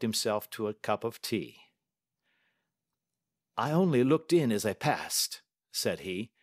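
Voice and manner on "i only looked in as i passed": lightly voiced calm detective, higher; a brisk, confident Holmes; the detective testing the banker, lightly voiced; Holmes, cool and measured. A narrator's reading. Holmes voiced while reading.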